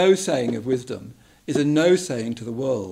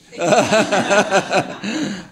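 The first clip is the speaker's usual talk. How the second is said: loud burst of laughter falling away; hearty laugh; 9 semitones higher, 10 dB louder than usual. Real, not acted. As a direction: let out a big real laugh. Shared joke with audience.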